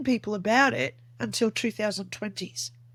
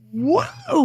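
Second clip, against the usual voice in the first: high, drawn-out startled whoa; taken aback; 11 semitones higher, 4 dB louder than usual. Retold or imitated. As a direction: reel back in surprise, pitch swooping up. Retold startled reaction to scolding.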